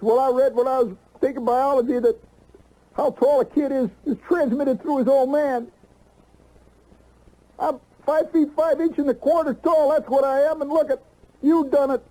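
put-on high, whining teenage son voice; whiny kid. Son whining at his father about his height, high sulky voice. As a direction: whine it like a sulky kid blaming his dad.

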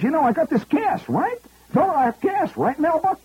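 high, brash Bronx New York voice; Fordham Road Bronx accent. Retold or imitated. Tipsy executive boasting to comic, in Bronx accent. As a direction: drop the English polish, go full Fordham Road. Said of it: "he's talking like he's from Fordham Road".

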